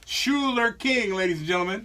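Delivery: very slow, drawn-out name reveal; big on-air introduction; radio announcer intro. Real, not acted. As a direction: announce the star to the audience, stretching the name. Radio show intro read.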